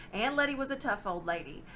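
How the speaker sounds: plain reading voice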